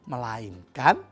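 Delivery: usual speaking voice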